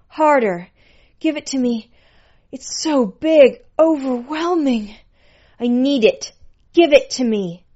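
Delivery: loud, high-pitched demands; urgent, impassioned high voice; ecstatic begging. A narrator's reading. Pet begging during sex scene.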